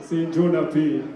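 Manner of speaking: normal talking voice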